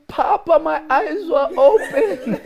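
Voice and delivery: high, loud and slow with laughter; joyful exclamation. Retold or imitated. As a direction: burst out laughing in delight. Retelling her joyful realization.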